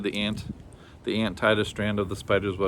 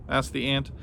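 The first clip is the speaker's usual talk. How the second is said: plain reading voice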